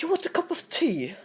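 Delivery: high, mock posh British accent; kid's hammed-up British accent. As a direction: play a comic British host offering tea. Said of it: "I'm really good at talking English".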